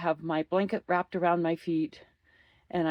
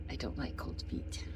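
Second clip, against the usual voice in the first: whispered aside; 3 semitones lower, 11 dB quieter than usual. Real, not acted. Aside, voice drops.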